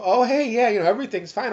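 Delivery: high, loud, over-casual phone greeting; retold breezy reassurance. Patient answering worried friends, forced breezy. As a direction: cheerily insist all is well. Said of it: "and I would go".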